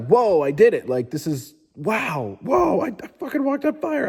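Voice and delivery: rising to a high, amazed whoa; pumped awe. Retold or imitated. Retelling his fire-walk to viewers, awed and pumped. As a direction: relive the thrill of walking on fire, voice jumping up. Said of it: "I was, like, pumped".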